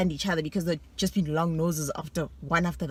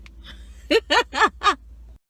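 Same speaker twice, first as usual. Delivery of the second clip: loud, high burst of laughter; gloating laugh; 12 semitones higher, 7 dB louder than usual. Real, not acted. Boasting she passed English, a loud high laugh.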